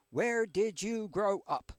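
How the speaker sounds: usual speaking voice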